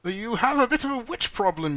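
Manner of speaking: high, loud heroic declaiming; grand proclamation. Acted. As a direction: address the mob like a hero on a stage. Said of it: "and I shall say".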